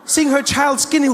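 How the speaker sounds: ordinary talk, nothing marked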